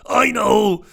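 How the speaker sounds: ordinary delivery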